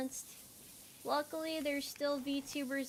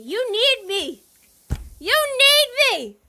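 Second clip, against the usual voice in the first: higher and much louder pleading shout; desperate yell; 10 semitones higher, 16 dB louder than usual. Pretend. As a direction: beg at the top of your voice. Desperate plea to chat.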